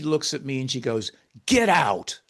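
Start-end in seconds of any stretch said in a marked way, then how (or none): 1.5-2.2 loud, higher retold order to leave; mock exasperated yell. Woman in her care orders out her noisy helper, mock yell. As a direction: imitate an irritated yell. Companion ordering celebrating speaker out.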